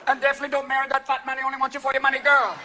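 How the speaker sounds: high-pitched TV psychic advice; high mock Jamaican fortune-teller; Miss Cleo. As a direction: counsel like a sassy phone psychic. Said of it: "are you working with Miss Cleo?".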